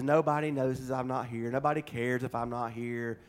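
low, soft mock-pitiful monotone; hammed-up woe-is-me, sinking low and quiet; mock self-pity. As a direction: play the overlooked member feeling sorry for himself. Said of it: "the gifts we think nobody notices".

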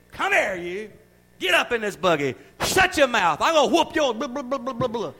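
put-on loud, high yelling at a child; a parent hollering at a child in public; scolding parent. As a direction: scold the kid loudly in the store. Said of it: "We'll jerk our kid by the head".

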